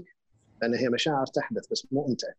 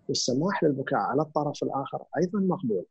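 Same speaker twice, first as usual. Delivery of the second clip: ordinary talk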